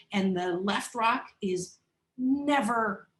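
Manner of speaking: usual speaking voice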